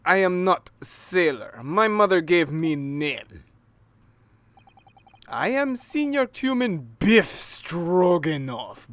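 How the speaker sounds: slow, broken-English sailor; a hint of a gruff foreign sailor. Player voices the sailor.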